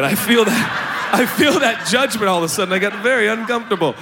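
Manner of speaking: loud laughing complaint about the judgment; real laughter mid-joke. Real, not acted. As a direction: laugh it out loud and call out the silence. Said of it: "Thanks for being so quiet".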